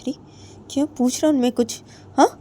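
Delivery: loud, high scolding after a slap; angry scolding. A narrator's reading. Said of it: "थप्पड़ मार देती है और बोलती है". (ऊँची आवाज़ में गुस्से से डाँटना)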